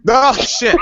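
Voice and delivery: laughing. Real, not acted. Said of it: "laughs at the quip".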